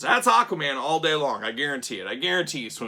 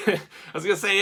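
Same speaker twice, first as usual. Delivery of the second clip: laughing; 6 semitones higher, faster than usual. Real, not acted. Laughs mid-sentence.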